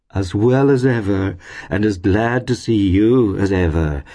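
hearty gentleman. A narrator's reading. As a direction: return the welcome with pompous cordiality. Pecksniff heartily returns her greeting.

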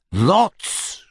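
high, rising cry of protest; astonished cry. A narrator's reading. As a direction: cry out in disbelief, sweeping upward.

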